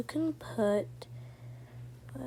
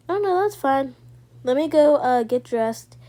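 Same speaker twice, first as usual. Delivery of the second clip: flustered surprise; 3 semitones higher, 11 dB louder than usual. Real, not acted. Caught off guard by Tim.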